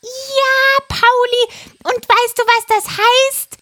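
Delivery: high, loud parrot voice; a frantic parrot urging; excited parrot. Acted. Parrot voice held. (hohe, aufgeregte Papageienstimme)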